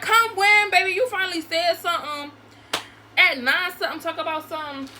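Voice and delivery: loud, high, exasperated challenge; re-enacted scolding. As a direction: call him out on his empty promise. Re-enacting scolding him for being late.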